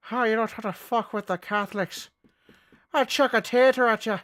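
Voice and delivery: high-pitched mock Irish accent.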